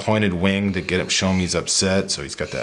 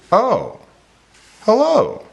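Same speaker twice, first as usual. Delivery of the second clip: high, loud oh, pause, higher hello; storybook greeting; 6 dB louder, much slower than usual. Shown as a demonstration. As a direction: exclaim in a high cartoon voice, pausing between words. Rereads the book line with punctuation.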